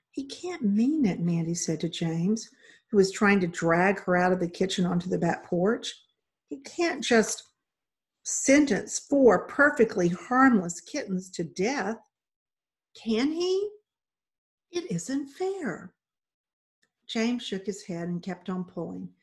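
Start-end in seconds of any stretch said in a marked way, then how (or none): 0.1-1.2 put-on higher, pleading young girl; dismayed protest. Girl protesting kittens' fate. 6.5-15.9 put-on high, pleading girl's voice; upset protest. Girl protesting kittens' death sentence.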